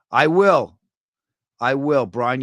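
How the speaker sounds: normal talking voice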